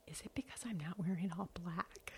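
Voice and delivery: soft unvoiced whisper of a worried guess; whispered quote. Sister-in-law's guess retold to the host, in a whisper. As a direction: whisper it, half-embarrassed. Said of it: "I can't even do her accent".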